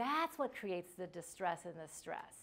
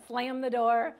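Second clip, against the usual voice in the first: ordinary talk, nothing marked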